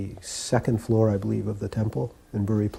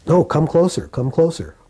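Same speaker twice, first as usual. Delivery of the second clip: higher, louder, insistent beckoning; guru beckoning; 7 semitones higher, 5 dB louder than usual. Retold or imitated. Guru calling a visitor from across the room, insistent beckoning. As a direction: urge him nearer, imitating an eager host.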